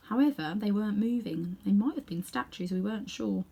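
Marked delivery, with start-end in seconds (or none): none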